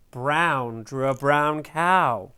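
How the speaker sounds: plain reading voice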